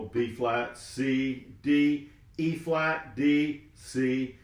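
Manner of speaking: slow, measured chant of note names with light accents; rhythmic note naming. Shown as a demonstration. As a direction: say each note on the beat, drawn out. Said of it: "putting a little emphasis on the accent notes".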